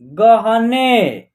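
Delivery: गहने very slow, drawn out; गहने stretched syllable by syllable; slow word blending. Shown as a demonstration. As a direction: model गहने slowly for the class. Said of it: "न में ए की मात्रा ने".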